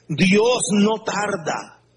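ordinary talk

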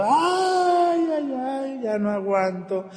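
"ay" wailed very high and loud, breaking into "ya no aguanto"; dying woman wailing. Retold or imitated. Dying woman wailing to the priest, very high howls. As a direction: cry out like a dying woman in agony. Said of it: "porque estaba gritando".

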